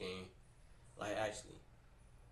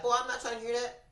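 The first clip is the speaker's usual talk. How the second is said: much higher, louder grandma brushing him off; dismissive grandma; 10 semitones higher, 5 dB louder than usual. Retold or imitated. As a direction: wave him off like a fed-up grandma.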